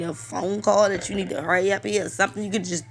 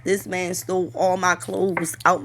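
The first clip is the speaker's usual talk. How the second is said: usual speaking voice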